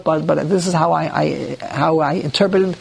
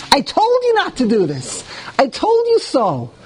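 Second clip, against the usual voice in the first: gloating Ruvain; 6 semitones higher than usual. Acted. Ruvain rubs it in.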